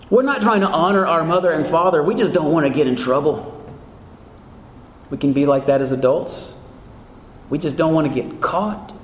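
ordinary talk, nothing marked

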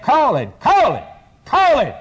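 rising to high, loud cries of "calling"; insistent calling out. Acted. Preacher showing how the blind man kept on, insistent calling out. Acts out persistence of blind Bartimaeus.

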